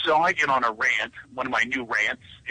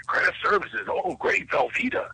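mock excitement; 7 semitones higher than usual. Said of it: "I was".

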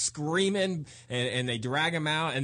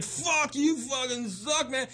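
high-pitched imitated heckler yell; heckler shout; 11 semitones higher, slower than usual. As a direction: holler abuse like a heckler. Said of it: "he's like".

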